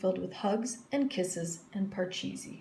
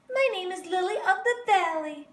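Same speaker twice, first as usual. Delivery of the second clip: put-on eager girl; 11 semitones higher, 5 dB louder than usual. A narrator's reading. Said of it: "said Victoria".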